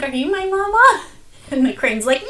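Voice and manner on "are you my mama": little bird asking. Retold or imitated. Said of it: "He's like".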